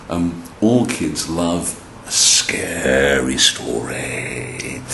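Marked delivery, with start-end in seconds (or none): 2.1-4.8 very slow, drawn-out spooky words; mock-spooky voice. Storyteller says all kids love these, playfully spooky. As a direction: stretch it out like a pretend ghost tale.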